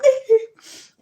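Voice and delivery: high sobbing laugh; real emotional laugh-cry; laugh cracking into a cry. Real, not acted. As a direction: laugh that breaks into tears. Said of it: "I can't be getting emotional".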